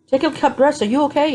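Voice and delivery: high mock concerned nurse; worried nurse. Nurse rushing in to check on patient, high and concerned. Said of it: "would come running in here".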